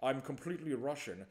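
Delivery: slow Scottish-accented actor impression; Sean Connery. Host mocks actor claiming to be Russian, Scottish impression. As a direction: draw it out in a Scottish burr, slushy "sh". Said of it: "He's like".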